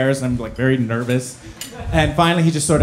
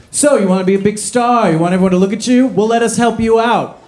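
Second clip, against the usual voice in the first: mocking cop; 7 semitones higher, 4 dB louder than usual. Cop taunting kid at school.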